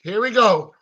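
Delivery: ordinary talk, nothing marked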